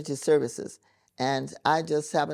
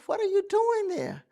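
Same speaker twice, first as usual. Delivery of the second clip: much higher, astonished cry; mock high surprised call to the husbands; baffled question; 4 dB louder than usual. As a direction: act amazed at the men on the ground. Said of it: "And we looked at them".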